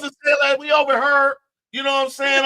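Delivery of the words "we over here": lightly put-on Saint Louis accent on "We over her". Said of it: "we put on that accent".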